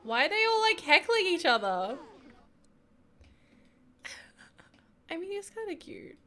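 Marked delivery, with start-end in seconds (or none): 0.0-2.0 loud, high disbelief; exclaiming loudly. Real, not acted. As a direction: call out in disbelief. Exclaiming at the heckling Sims.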